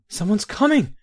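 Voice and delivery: startled shout of warning; alarmed cry. A narrator's reading. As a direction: warn in alarm. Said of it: "Baron Zaya cried in alarm".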